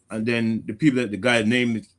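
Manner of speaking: ordinary talk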